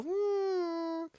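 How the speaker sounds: high drawn-out hum; thin imitated squeal; imitated whine. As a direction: hold a soft, high, whining "mm". Imitates a little noise.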